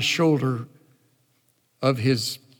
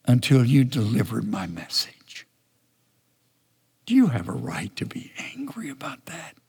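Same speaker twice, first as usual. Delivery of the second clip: gentle God. Acted. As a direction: plead gently, let it fall to a hush. God continues to Jonah.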